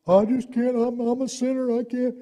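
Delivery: high-pitched helpless excuse-maker; helpless sinner. Acted.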